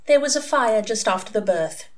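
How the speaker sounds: vacant, hollow answer; entranced reply. A narrator's reading. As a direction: recite it blankly, as if hypnotised. Entranced ex-nun answers questions.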